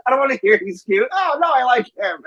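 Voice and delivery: high, put-on squabbling voice; mock bickering. Son and mom squabbling over the wrestler, high mock voices. Quoted back-and-forth with mom.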